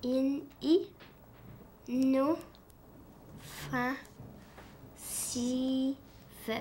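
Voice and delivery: "In, i, no, fa, si, fe" as separate sounds with long pauses; syllable by syllable. Shown as a demonstration. Child splits word for teacher, "In, i, no, fa, si, fe" as isolated syllables. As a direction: sound out "In, i, no, fa, si, fe" one piece at a time. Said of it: "Fais-moi tes syllabes".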